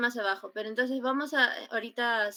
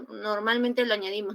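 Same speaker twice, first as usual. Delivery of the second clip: ordinary talk, nothing marked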